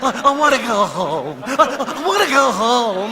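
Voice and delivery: slow, drawn-out blubbering lion wail; Cowardly Lion. Acted. Man clowning as the lion in traffic, slow comic wailing. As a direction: wail it like a big cowardly beast, dragging each word. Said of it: "I turn into the lion from The Wizard of Oz".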